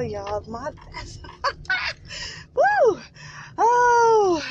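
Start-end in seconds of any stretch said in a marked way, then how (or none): 1.9-4.4 joyful laugh and whoop. Real, not acted. Worshipper overwhelmed by a worship song, laughing whoop. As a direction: spill over with giddy joy. Overcome with joy again.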